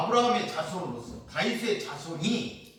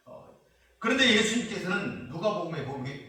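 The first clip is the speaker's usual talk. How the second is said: ordinary talk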